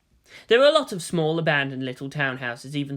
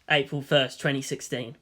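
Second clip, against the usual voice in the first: ordinary delivery, nothing marked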